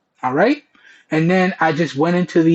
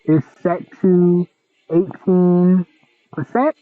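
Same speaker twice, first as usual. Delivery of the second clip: earbud mic in crowd noise; bluetooth headset mic. Real, not acted. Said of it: "Okay, and I'm just gonna start talking".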